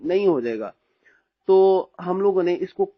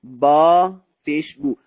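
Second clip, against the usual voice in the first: slow and louder, each "बा" held long; long drill syllables; 5 dB louder, much slower than usual. Shown as a demonstration. Teacher drills students slowly and loudly. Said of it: "बा को लंबा क्यों कर रहे हैं".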